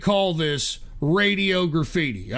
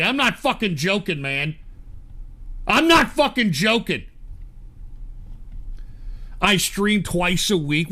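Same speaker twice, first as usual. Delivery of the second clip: ordinary delivery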